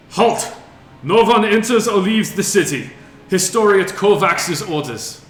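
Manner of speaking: loud, high commanding guard; guard captain shouting. Acted. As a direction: bark orders from the wall. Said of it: "So bad. It's terrible".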